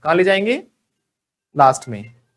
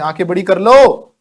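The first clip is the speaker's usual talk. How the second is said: wide-eyed warning; 9 dB louder than usual. Pretend. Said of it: "जैसे हम किसी की".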